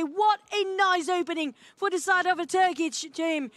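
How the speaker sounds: building to a loud, high call; excited play-by-play. Real, not acted. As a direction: crank up the hype as the kill lands. Caster builds up to the kill.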